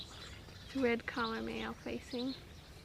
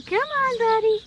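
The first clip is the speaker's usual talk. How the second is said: much higher, much louder coaxing; squeaky praising a dog; 10 semitones higher, 16 dB louder than usual. Real, not acted. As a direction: squeal it to a puppy. Coaxing the puppy.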